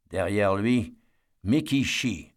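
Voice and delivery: plain reading voice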